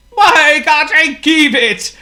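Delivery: high, loud, slow whine; whining plea. A narrator's reading. Potto begs Hippo to keep the plane, high whine. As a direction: beg like a spoilt child. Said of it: "cried Potto".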